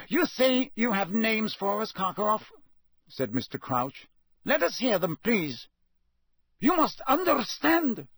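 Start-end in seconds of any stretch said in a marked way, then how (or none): 0.1-2.5 higher, cold magistrate; stern official. A narrator's reading. Crouch questions prisoner. 4.4-5.7 higher, cold magistrate; stern official. A narrator's reading. Crouch presses prisoner. 6.6-8.1 high, hasty pleading; hurried, nervous. A narrator's reading. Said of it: "said Karkaroff hurriedly".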